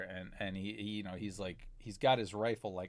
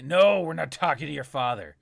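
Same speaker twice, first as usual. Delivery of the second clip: louder, curt refusal; thug snapping back; 4 semitones higher, 10 dB louder than usual. Acted. The Trandoshan cutting Jonathan off, louder and harsh. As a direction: shut him down hard.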